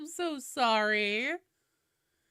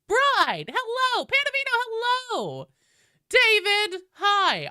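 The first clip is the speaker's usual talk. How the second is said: sing-song greetings; 9 semitones higher, 5 dB louder than usual. Real, not acted. Roll call greeting chatters.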